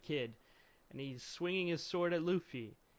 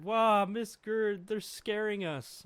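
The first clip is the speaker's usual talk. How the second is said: put-on high, drawn-out tearful kid wail; whining children; 6 semitones higher, 7 dB louder than usual. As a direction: whine for protection like scared kids. Children run crying to Gerd.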